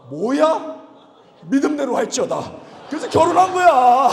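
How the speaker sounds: loud, high, laughing blessing; excited. Retold or imitated. Pastor blessing the brother's confession, loud and laughing. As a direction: shout it out with a laugh.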